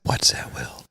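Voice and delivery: unvoiced hushed question; whispering. Real, not acted. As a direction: whisper it like a secret. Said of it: "I'm whispering".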